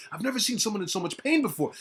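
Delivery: usual speaking voice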